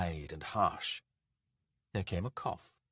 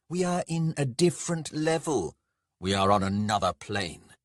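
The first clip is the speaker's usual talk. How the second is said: loud, raised proclamation; pompous, ringing dwarf lord; lofty god; 3 semitones higher, 5 dB louder than usual. Acted. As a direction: declare your divinity to a mere mortal. Lord proclaims godly transcendence.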